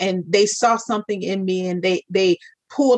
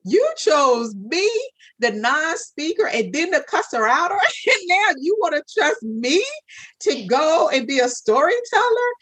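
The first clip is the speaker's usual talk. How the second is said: much higher, half-laughing disbelief; incredulous disbelief; 7 semitones higher than usual. Retold or imitated. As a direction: laugh it off in stunned disbelief, like Moses. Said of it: "that's when I was Moses".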